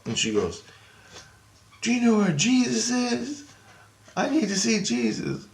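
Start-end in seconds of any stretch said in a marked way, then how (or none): 1.8-5.5 put-on high little-girl voice; twelve-year-old girl. Little girl asks a stranger for Jesus, high child voice. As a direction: plead like a small child, pitched way up. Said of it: "she talking like she's twelve years old".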